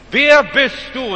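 a preacher's ringing question; challenging question. Real, not acted. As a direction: press the question home slowly. Preacher asks loudly.

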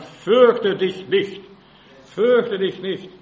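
slow, booming fürchte dich nicht; a slow, loud preacher's call not to fear; loud proclamation. Acted. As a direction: thunder the command across the hall. Preacher voices Jesus' command.

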